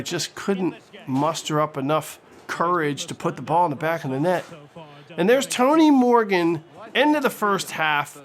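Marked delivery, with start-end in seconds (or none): none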